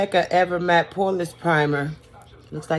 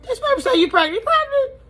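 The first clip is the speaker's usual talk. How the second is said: high, shrill-pitched disbelieving mom voice, much higher; shocked mom; 3 dB louder than usual. Retold or imitated. Mom reading the papers, asking in high shocked disbelief. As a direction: gasp out the question in total disbelief.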